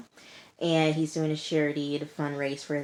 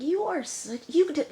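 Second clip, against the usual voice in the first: much higher, strained cut-off insult; exasperated outburst; 12 semitones higher than usual. Real, not acted. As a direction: bite back the insult mid-word. Speaker venting at Alex on screen.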